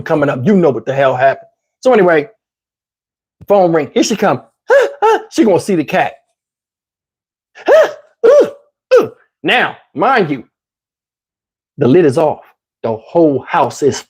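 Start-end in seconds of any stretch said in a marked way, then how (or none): 4.7-5.2 sharp high mock gasp; sudden gasp of alarm. 9.4-9.8 sudden gasp of alarm. Retold or imitated. Mock gasp again.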